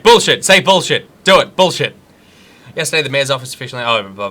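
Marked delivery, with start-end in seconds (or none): 0.0-2.0 loud, higher imitation of an egging-on crowd; mock crowd clamour. Streamer mimicking the chat egging him on, mock clamour. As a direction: goad loudly like a crowd. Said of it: "Everyone's like".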